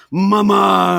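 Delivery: high, loud, drawn-out call; mock shouting. Chef whipping food at the wife, mock shouting. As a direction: belt out "mama" as an over-familiar chef. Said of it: "he just kept shouting that".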